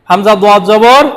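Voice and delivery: usual speaking voice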